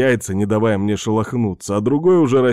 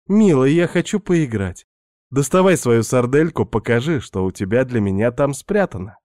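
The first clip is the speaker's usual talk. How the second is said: Svetka's voice; 3 semitones higher than usual. Acted. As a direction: play the pushy houseguest luring him in. Narrator voices seductive Svetka.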